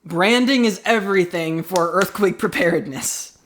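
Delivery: ordinary talk, nothing marked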